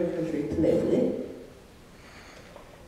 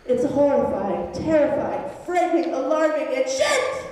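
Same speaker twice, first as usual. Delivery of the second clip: mounting mock horror; 7 semitones higher than usual. A narrator's reading. Narrator escalating horror list.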